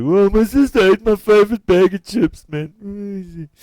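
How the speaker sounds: very high, loud cartoonish voice; mock casual complainer. Shown as a demonstration. Teacher mimicking everyday talk to the class, mock casual squeal.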